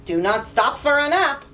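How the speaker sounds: ordinary delivery, nothing marked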